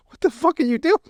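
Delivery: high-pitched, incredulous imitated protest; startled mock outrage. Imagining someone busting into the bathroom, startled mock outrage. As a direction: yelp it as if walked in on, at normal volume.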